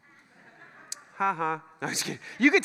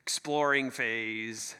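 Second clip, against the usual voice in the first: dismissive shrug; 7 semitones lower, slower than usual. Retold or imitated. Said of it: "those are shruggers to you".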